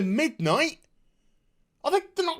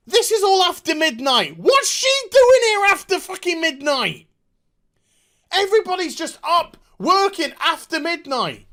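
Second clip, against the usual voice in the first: louder, higher fed-up shouting; exasperated rant; 7 semitones higher, 4 dB louder than usual. Real, not acted. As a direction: yell at the absurd plot. Ranting at plot holes.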